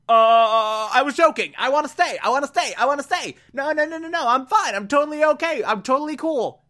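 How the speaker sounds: high, frantic mock pleading; panicked backpedaling. The scared man begs the group to let him stay, high mock panic. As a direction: squeak out the take-back in a rush.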